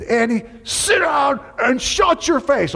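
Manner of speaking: high, drawn-out shouted command; retold scream. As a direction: re-enact losing your temper at a student. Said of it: "I screamed it at the top of my lungs".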